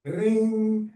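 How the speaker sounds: ordinary talk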